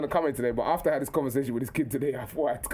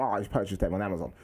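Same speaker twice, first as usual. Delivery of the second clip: lower, precise pupil voice; matter-of-fact, well-spoken kid, pitched down; formal schoolboy impression; 7 semitones lower than usual. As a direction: mimic an oddly proper schoolboy. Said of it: "he was talking like, like this".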